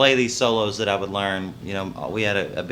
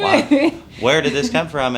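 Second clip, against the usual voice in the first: higher, laughing imitation of puzzled listeners; mock bafflement; 5 semitones higher, 4 dB louder, slower than usual. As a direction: act out baffled disbelief, half laughing. Retold public puzzlement at newcomer.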